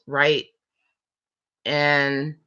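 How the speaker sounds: ordinary talk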